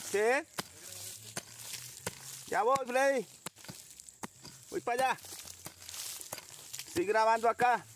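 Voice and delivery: high, carrying reply to a companion; calling out. Real, not acted. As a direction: holler back across the field. Answers companion from a distance.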